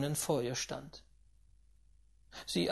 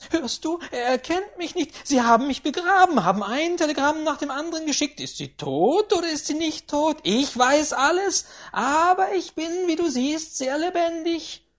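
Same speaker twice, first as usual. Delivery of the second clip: put-on high, loud, domineering old lady berating; a rich old tyrant who brooks no nonsense; imperious grandaunt; 10 semitones higher, 7 dB louder than usual. A narrator's reading. Grandaunt scolding the tutor and her steward, high and commanding. Grandaunt triumphant at being alive.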